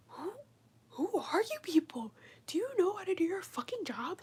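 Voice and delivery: hushed exasperation at the fumbling nurses; incredulous. Retold or imitated. Questioning the nurses' competence, high incredulous whisper.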